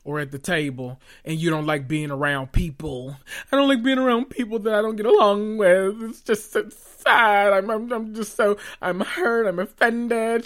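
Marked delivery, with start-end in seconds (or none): 3.5-10.4 higher, whiny put-on wounded voice; mock her fake hurt feelings; mocking Sutton. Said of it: "acting as if".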